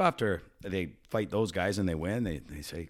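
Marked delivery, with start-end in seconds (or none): none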